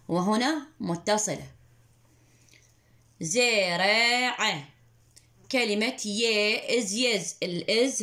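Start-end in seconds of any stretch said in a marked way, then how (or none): 3.2-4.6 slow model word. Shown as a demonstration. Teacher to pupil, drawing out زرع very slowly. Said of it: "teacher models word slowly".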